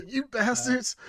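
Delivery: drawn-out, high mock curse; mock outrage. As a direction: echo the curse in a high, put-on voice.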